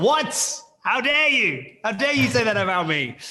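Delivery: high-pitched, indignant protest; playfully scandalized, voice jumping up; mock outrage. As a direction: act hammily offended by an insult. Retold mock outrage at insult.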